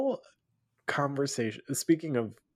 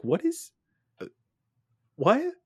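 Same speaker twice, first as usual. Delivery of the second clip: high-pitched, halting mock shock; re-enacted baffled squeak; stunned disbelief; 14 semitones higher, 3 dB louder than usual. Retells jaw-dropped disbelief at a film.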